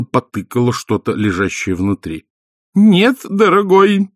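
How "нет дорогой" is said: high, slow, firm wifely no. A narrator's reading. Wife overruling her husband, firm and high. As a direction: shut him down firmly. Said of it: "твёрдо ответила она".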